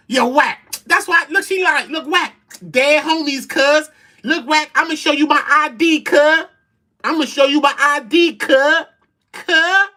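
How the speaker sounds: high, loud mimicking of his protest; mocking Loose's voice. Mocking a man on Clubhouse swearing on his dead homies. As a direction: ham up his whiny "look, whack" plea. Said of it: "That's exactly how she, he bugging".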